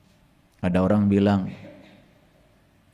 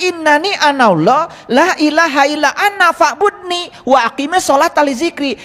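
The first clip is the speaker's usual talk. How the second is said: Quran recitation; 7 semitones higher than usual. Acted. Quotes the Quran in Arabic.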